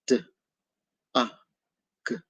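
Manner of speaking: "D, ah, g" as three separate sounds with pauses; sounded out. Shown as a demonstration. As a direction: sound out "D," "ah," "g" one by one, gently. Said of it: "Now let's blend them together".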